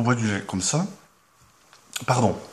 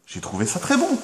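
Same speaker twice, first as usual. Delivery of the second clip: "J'ai trouvé ça très bon" said much higher, rising on "bon"; high delighted repeat; 13 semitones higher than usual. Shown as a demonstration.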